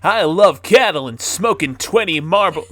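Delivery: southern voice. Pretend. Streamer shitposting in a mock Southern US accent. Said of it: "my southern voice".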